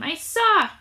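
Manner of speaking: put-on boy shouting as he races over; shrill high shout. A narrator's reading. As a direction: yell it while running up. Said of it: "shouting in his shrill, high voice".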